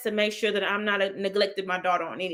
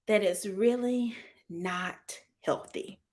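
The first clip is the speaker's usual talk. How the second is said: slow, softer and measured; real calm; 2 semitones lower, 7 dB quieter, slower than usual. Real, not acted. Talking about parenting in her community, slow real calm. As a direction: drop into a calm, deliberate delivery. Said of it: "I got real calm when I said it".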